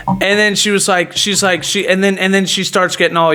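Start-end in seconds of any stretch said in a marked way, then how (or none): none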